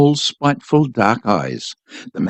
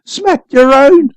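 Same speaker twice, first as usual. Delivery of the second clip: much higher, slow and drawn out; hysterical scream; 12 semitones higher, 4 dB louder, slower than usual. A narrator's reading. The boy screaming back at his father, high and drawn out. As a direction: shriek it at him, beside yourself. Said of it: "I screamed hysterically".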